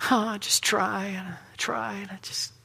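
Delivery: soft, hammed-up whining, pitch climbing; put-on woe-is-me moan; mock self-pity. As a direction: play the poor struggler feeling sorry for himself. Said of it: "so in self-pity".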